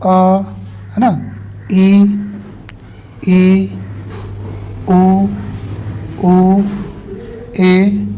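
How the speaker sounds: each vowel sound held alone, with long pauses between; vowel sounds demo. Shown as a demonstration. Teacher sounds out vowels for students, each held apart. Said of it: "sound जो आना चाहिए वो".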